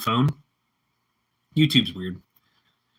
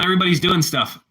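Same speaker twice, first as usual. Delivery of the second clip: ordinary talk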